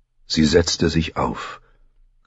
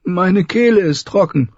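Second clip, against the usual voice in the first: put-on higher young queen calling from the sickbed; calling weakly; 14 semitones higher than usual. A narrator's reading. Thirsty Dany calls her maids, high and parched. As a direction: call out, parched and weak. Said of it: "rief sie".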